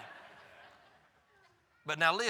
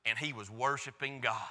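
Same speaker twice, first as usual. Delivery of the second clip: usual speaking voice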